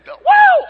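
very high, loud mock whoop; hammed-up falsetto woo. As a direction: squeal like the pop star.

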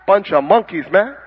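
ordinary talk

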